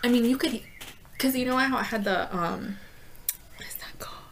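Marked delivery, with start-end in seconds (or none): 3.6-4.2 whispered aside to self; whispering. Real, not acted. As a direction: whisper it under breath. Searching for a word.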